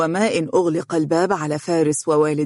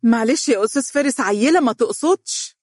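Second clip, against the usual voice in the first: high-pitched apologetic mother; hurried, placating apology; 7 semitones higher than usual. Acted. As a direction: excuse your child in a lifted voice. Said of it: "تقول لفارس باعتذار".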